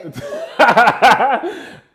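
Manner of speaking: high, loud burst of laughter. Real, not acted. Laughs at the lie detector joke.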